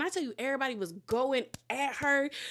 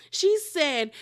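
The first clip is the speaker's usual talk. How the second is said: ordinary delivery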